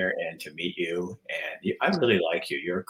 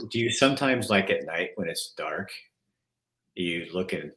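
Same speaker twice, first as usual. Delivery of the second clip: ordinary talk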